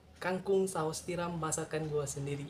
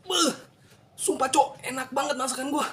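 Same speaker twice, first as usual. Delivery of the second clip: thrilled, higher and louder; excited; 8 semitones higher, 5 dB louder than usual. Real, not acted. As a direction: rave about the taste. Tasting his own cooking.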